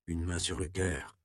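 usual speaking voice

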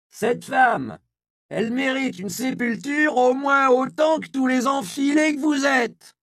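put-on high, loud hoarse old woman's shout, slowing; hoarse powerful yell. A narrator's reading. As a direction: yell down the crowd like a town crier. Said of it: "reprit Isia". (la vieille Isia hurle à la foule, voix rauque et puissante)

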